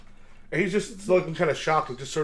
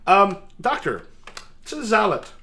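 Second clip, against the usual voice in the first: knight voice; 4 semitones higher, 5 dB louder than usual. Acted. Knight addressing the doctor.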